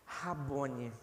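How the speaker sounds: Rabone said low and soft, falling; hushed Rabone. Acted. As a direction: breathe Rabone in reverent awe. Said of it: "ouviu a voz do seu Senhor". (Rabone dito baixinho, com reverência)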